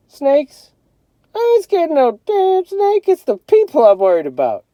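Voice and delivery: high, loud, brash voice; tough older brother. A narrator's reading. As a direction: show off fearless to the little brother.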